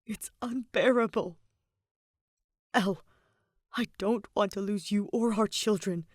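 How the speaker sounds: lightly voiced heartbroken husband, close to tears; a hint of a desperate man pleading; grieving husband voice. Byleth pleading with his wife.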